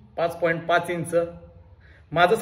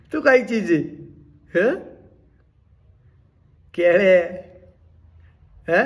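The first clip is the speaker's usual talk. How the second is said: scolding; 3 semitones higher, 6 dB louder than usual. Acted. Acted scolding of debtor friend.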